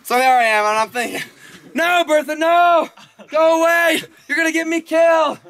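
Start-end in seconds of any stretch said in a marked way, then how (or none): 1.7-5.4 high, urgent yelling to ward someone off; acted alarm, pitched way up; panicked warning. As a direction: plead loudly for them to go away. Teller acts out warning Bertha off.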